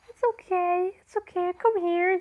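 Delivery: high, gentle soothing to a cat. Retold or imitated. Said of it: "I was just".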